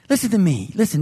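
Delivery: ordinary delivery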